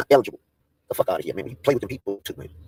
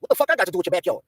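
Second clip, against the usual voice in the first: usual speaking voice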